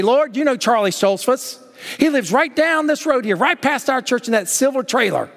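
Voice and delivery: an elder pleading over a neighbour; earnest praying elder. Retold or imitated. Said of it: "He said he'd say".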